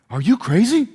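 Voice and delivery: mock disbelief from the scowling man; gruff man taken aback; startled big man. Voicing the barricaded man.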